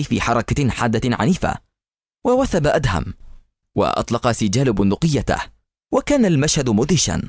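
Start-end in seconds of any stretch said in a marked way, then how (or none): none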